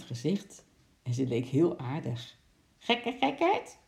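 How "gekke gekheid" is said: slow, high parrot squawk; parrot calling out. A narrator's reading. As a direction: draw it out as a high, rising parrot call. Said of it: "riep Lorre tegen de mevrouw". (hoge, langgerekte papegaaienroep)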